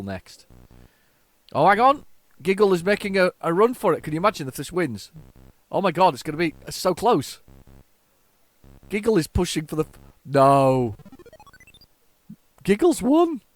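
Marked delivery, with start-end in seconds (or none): 1.5-13.4 high, loud and urgent; excited race reaction. Real, not acted. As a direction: call the close finish in rising excitement. Reacting to close virtual race finish.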